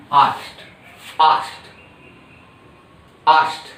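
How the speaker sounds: asked said as ast, no k; asked with the k dropped; correct asked. Shown as a demonstration. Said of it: "People often, uh, pronounce asked".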